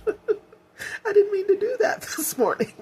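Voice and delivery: embarrassed laugh while crying; teary giggle over the words; laughing through tears. Real, not acted. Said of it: "let me wipe these tears away".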